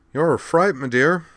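high, loud put-on cheeriness; falsely cheerful, lifted voice. A narrator's reading. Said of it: "he said with false cheer".

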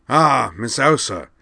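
slow, warm alien welcome; warm greeting. A narrator's reading. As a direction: greet her kindly and slowly. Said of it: "said Jakron warmly".

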